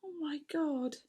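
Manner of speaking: whispered, breath only, falling; stunned whisper. Real, not acted. Overwhelmed after imitating.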